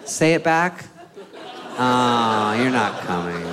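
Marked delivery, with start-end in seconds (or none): none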